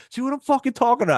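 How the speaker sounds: high, fast mock outburst; high-pitched rapid outburst; vindicated customer. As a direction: imitate the guy gloating at the theft. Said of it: "the guy goes".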